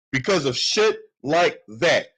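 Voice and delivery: slow, pointed repeat of shit like that; slow emphatic repeat. Real, not acted. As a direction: drive it home slowly, leaning on shit.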